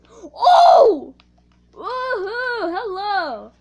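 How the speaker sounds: loud high cheering whoops; excited whoop. Real, not acted. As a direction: celebrate the loot loudly. Excited at getting chest.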